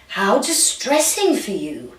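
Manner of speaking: slow, drawn-out posh British sympathy; posh British swan. Acted. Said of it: "Let's make it a posh swan".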